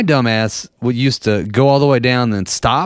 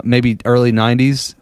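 normal talking voice

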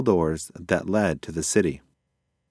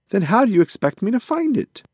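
high, loud incredulous question; exasperated; 13 semitones higher, 5 dB louder than usual. A narrator's reading. Pigeon challenges his unsure passenger, exasperated and high. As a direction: throw the question back, voice climbing sharply.

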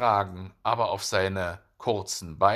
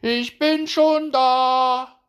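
very high, loud, stretched call; slow triumphant holler, pitched way up; wife calling out; 10 dB louder, much slower than usual. A narrator's reading. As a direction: sing out in falsetto to the runner. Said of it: "rief ihm die Igelfrau entgegen".

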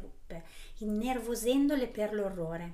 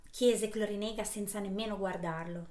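plain reading voice

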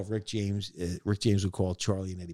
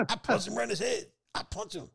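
[name] voice; 10 semitones higher than usual. Retold or imitated. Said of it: "[name] like".

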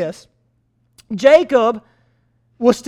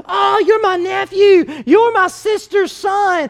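higher, delighted greeting to his nephew; excited uncle; 7 semitones higher than usual. Retold or imitated. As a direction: gush over the long-lost nephew. Laban greets Jacob.

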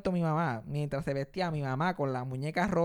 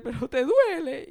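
worried dad; 9 semitones higher, 6 dB louder, slower than usual. Retold or imitated. Dad anxiously asking crying.

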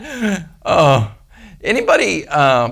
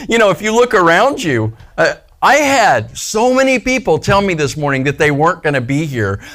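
usual speaking voice

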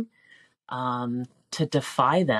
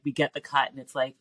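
usual speaking voice

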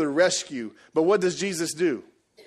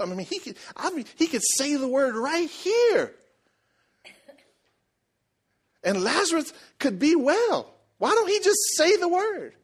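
rising higher and higher, baffled griping; complaining disciple; 10 semitones higher than usual. Retold or imitated. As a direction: build from puzzled to exasperated disbelief. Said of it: "I can see his disciples in the corner".

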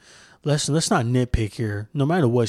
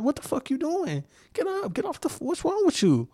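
much higher and softer, squeaky through laughter; laughing mock yell; 4 dB quieter than usual. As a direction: cackle it at someone on the ground. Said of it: "I'd have been cracking the fuck up".

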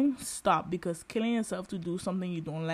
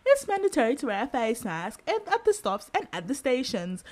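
higher, louder, mock public-transport announcement voice; annoying tram announcer lady; 6 semitones higher, 5 dB louder than usual. Mocking the tram's recorded announcer, higher and louder announcement voice. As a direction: imitate the tinny automated tram lady. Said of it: "them annoying lady voices on the trams".

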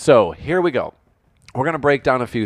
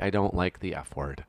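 quiet, lightly voiced mom; a soft hint of a prim mother; 4 semitones lower, 8 dB quieter than usual. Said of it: "this is my mom voice for today".

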